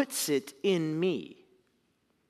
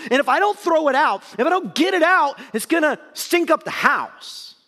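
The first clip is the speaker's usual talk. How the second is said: louder and higher, building as the quote goes on; impassioned Paul; 6 semitones higher, 4 dB louder than usual. Acted. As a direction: preach Paul's words with rising fervour. Preacher voicing Paul's confession.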